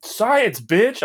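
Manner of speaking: excited high shout of a catchphrase; Jesse Pinkman. Retold or imitated. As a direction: bark it like an amped-up young punk. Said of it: "calling everyone bitch".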